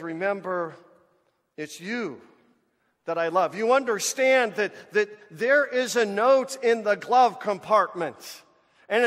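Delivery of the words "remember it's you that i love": God speaking tenderly. Retold or imitated. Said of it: "He says".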